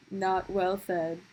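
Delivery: ordinary talk, nothing marked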